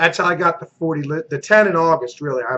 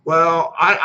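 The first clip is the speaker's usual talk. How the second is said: ordinary talk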